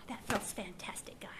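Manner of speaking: whispered, relaxed and breathy; relieved whisper. Retold or imitated. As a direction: breathe it out like lying in the grass. Whispered sigh of relief resting.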